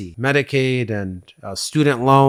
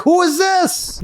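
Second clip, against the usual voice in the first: high, slow, irritated bark at an unknown caller; pissed-off pickup; much slower than usual. Pretend. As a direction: snap it out high and slow like an annoyed man answering a stranger. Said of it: "Now I'm pissed off".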